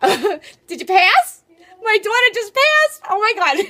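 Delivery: mother calling to her daughter about the exam, overjoyed; real excitement. Real, not acted. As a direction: shriek with delight at the good news.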